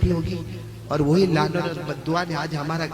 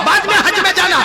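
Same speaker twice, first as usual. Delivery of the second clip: mother scolding loud and high; angry mother; 12 semitones higher, 8 dB louder than usual. Retold or imitated. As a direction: scold the boy sharply, raised voice. (गुस्से में डाँटती माँ)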